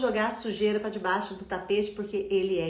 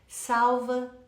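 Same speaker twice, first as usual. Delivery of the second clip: ordinary delivery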